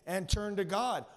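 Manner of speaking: a stern command from the prophet; a strong call to repent; loud rebuke. Retold or imitated. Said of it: "he drops it on them like this".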